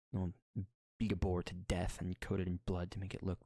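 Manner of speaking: ordinary talk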